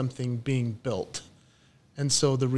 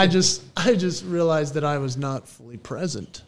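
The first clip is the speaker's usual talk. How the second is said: normal talking voice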